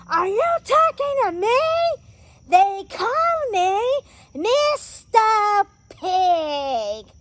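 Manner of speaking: high, loud mock-outraged shout; Pumbaa's outraged roar. Reviewer acting Pumbaa confronting the hyenas, outraged shout. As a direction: build to a drawn-out, indignant bellow.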